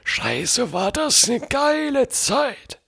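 put-on high, shaky old man voice; old grandpa voice. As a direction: play an old grandpa looking back. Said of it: "mit so einer Opa-Stimme".

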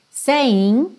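"Saying" ending on N with no G; no final G. Shown as a demonstration. A teacher showing "Saying" without the final G. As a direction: drop the G at the end of "Saying". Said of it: "você não pronuncia o G final".

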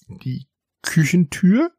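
slow, high, rising question; puzzled echo, drawn out and climbing at the end; somewhat astonished. A narrator's reading. Cat questions the mouse's plan, somewhat astonished. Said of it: "fragte Isabella etwas erstaunt".

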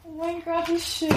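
high delighted gushing. Real, not acted. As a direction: gush over a lovely gift. Admiring gift, delighted.

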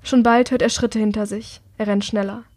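usual speaking voice

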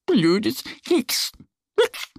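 a touch of weary sulk, little duck; sighing duck. A narrator's reading. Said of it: "seufzt Annie".

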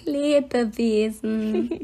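Lebewesen drawn out very slowly, syllable by syllable. Shown as a demonstration. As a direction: stretch Lebewesen, le-be-we-sen. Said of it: "Das klang grad wie".

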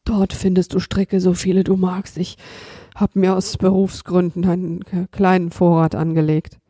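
lightly voiced tired robber; weary robber voice. The robber points Kasperl to his ropes, weary and feeble. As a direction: say it limp and worn out. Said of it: "mit matter Stimme".

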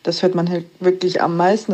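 ordinary delivery

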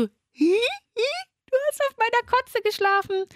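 high, giggly imitation of the friend; mock the friend's carefree giggle; giggling innocently. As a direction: friend giggling as she reveals the vomit. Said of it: "lacht die Rika so arglos".

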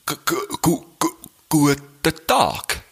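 stammer, then drag out guten Tag slowly; halting, drawn-out stutter; stuttering reply. Acted. Second stutterer answers on the bridge, stammering slowly. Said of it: "die stottern".

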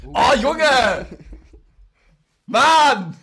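hoch und laut aufstöhnen; genervter Ausruf. Real, not acted. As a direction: fassungslos über den Kollegen ausrufen. Genervt über Nicks Albernheit. (genervter, hoher Ausruf über Nicks Albernheit)

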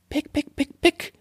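very high chirpy pick sounds; bird pecking. Acted. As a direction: peck it out like a little bird. Andere Vögel picken mit. (hohes Picken wie ein Vöglein)

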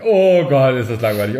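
loud burst on OH GOTT, then dropping; genervtes Stöhnen. Retold or imitated. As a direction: groan out your boredom loudly. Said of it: "ich merkte schon so". (laut und genervt gestöhnt)

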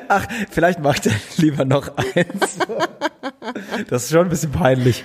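embarrassed laughter through words; laughing. Real, not acted. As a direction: laugh while speaking. Embarrassed about his photo.

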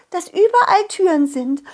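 squeaky little fairy, high and eager; an excited little fairy reading her list; high fairy child voice. Acted. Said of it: "Und dann legte sie los".

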